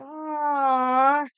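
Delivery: put-on high, loud childlike whine; milk the self-pity; mock whiny aw. Complaining about being handcuffed, mock whiny aw.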